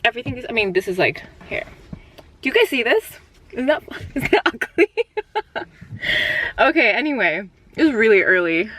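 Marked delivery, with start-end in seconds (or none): 2.4-4.9 giggling through the words; high laughing disbelief. Real, not acted. Laughing at her bad lighting. 5.0-6.5 breathy high giggle; airy giggle, pitched way up; high laugh. Real, not acted. Laughing at her bad lighting.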